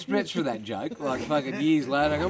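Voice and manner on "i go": usual speaking voice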